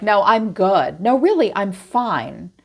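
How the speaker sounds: mock insistent denial. Imitating a stubborn listener.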